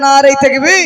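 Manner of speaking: loud rallying cry rising at the end; loud, rising takbir call; shouted slogan. Real, not acted. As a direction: yell it for the crowd to shout back. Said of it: "আরো চিল্লে দাও".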